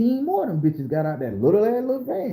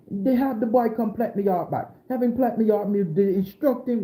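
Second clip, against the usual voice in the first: high raised voice of an angry Jamaican man; Jamaican man's demand; 6 semitones higher than usual. Retold or imitated. Jamaican man telling Grandma what the boy owes him, high and indignant. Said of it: "And he said".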